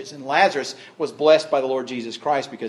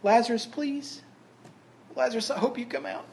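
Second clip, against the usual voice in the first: soft, high, hesitant pleading; timid plea; 8 semitones higher, 7 dB quieter than usual. Acted. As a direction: ask timidly, barely daring to hope. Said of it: "It wasn't a timid".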